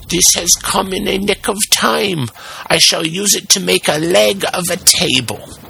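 put-on high-pitched old carpenter character voice; old carpenter to himself. A narrator's reading. Carpenter finding wood for a table leg, high self-talk. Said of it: "he mumbled half to himself".

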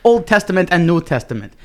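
put-on broken-English foreign accent, lightly Irish; a self-important potato prophet with a vague Irish lilt; mock foreign accent. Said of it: "Says the man who sounds Irish".

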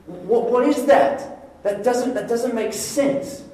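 high, slow and baffled; a stunned, drawn-out what is that; Paul's high, slowed disbelief at his reprieve; incredulous disbelief. Retold or imitated. Paul baffled at getting a job.

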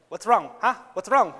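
lightly voiced mom, loud quick questioning; concerned mom. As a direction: imitate a mother pressing to know what's wrong. Voices mom's worried questioning.

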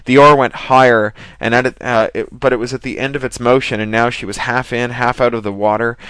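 ordinary delivery, nothing marked

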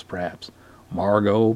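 ordinary delivery

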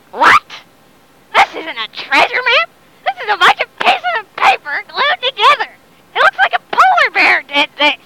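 high-pitched, incredulous outburst; outraged pirate. Acted. Pirate examines the fake treasure map, high outraged protest.

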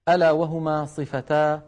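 ordinary talk

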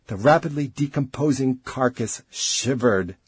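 ordinary delivery, nothing marked

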